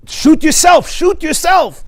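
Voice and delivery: loud, high pleading shout; urgent yell. Retold or imitated. Boy begging the policeman during the attack, urgent loud yell.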